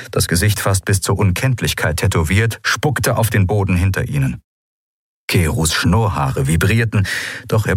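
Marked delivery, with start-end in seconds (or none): none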